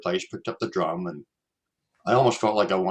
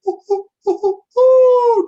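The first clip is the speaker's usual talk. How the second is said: high, loud hooting owl calls; high, loud imitated owl hoots; 11 dB louder, slower than usual. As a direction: hoot like an owl out in the dark woods. Imitating owl call again.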